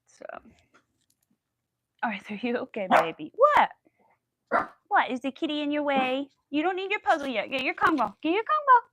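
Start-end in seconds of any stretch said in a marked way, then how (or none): 2.0-8.8 pet talk. Real, not acted. Talking to Arthur the dog in high baby talk.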